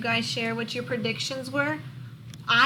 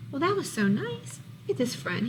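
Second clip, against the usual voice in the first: ordinary delivery, nothing marked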